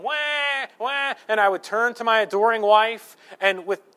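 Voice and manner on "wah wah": high, drawn-out mock baby cry; "wah, wah", pitched way up; imitated baby wail. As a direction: wail like a newborn in the night. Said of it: "I would hear them".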